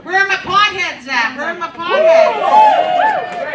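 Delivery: loud drawn-out call to the stoners. Acted. Comic calling out to the stoners in the crowd, loud and drawn out. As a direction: holler it out to the crowd, stretching potheads.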